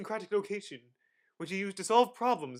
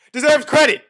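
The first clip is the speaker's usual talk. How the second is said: loud, higher shouted ending; dramatic shout; 10 semitones higher, 19 dB louder than usual. Acted. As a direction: belt out the punchline. Dramatic shout about the controversy.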